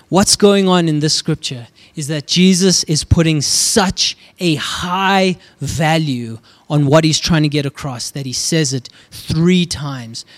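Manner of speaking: normal talking voice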